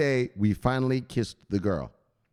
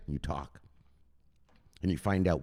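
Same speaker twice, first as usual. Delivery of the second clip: ordinary delivery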